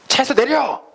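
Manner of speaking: high, sharp yell from an angry driver. Acted. Driver yells at the one grabbing his wheel, furious shout. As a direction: bark him out of the car.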